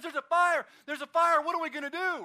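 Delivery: high, loud frantic cry; panicked woman. Retold or imitated. Neighbor tells the pastor in a frantic panic. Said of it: "She goes".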